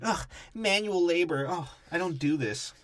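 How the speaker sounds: lightly voiced old rocker whining about work; retired rock star. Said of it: "like a retired old rock star".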